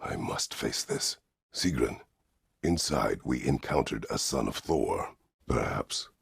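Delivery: normal talking voice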